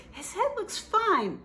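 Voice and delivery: high, slow, lightly voiced vet. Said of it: "'cause they said".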